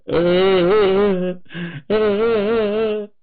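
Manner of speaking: loud, high acted sobs; wife bursting into loud sobs; sobbing. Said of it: "反倒哭出来了".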